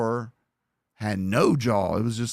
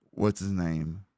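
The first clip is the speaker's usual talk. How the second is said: ordinary talk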